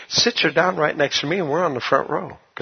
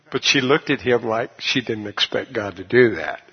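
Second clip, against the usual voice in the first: ordinary delivery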